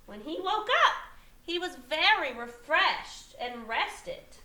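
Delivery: expressive storybook reading with lifted stresses; story read to children. A narrator's reading. Teacher reading a picture book to young children, animated storytelling. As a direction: read it aloud, drawing out the big moments.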